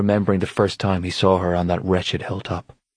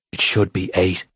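grumbling. A narrator's reading. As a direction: grumble it under your breath. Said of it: "Cormac grumbled".

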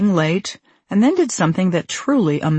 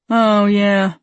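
drawn-out cartoon dad reply; long, held Homer line; 2 semitones higher than usual. A narrator's reading. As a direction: stretch it out as Homer. Voicing Homer in script.

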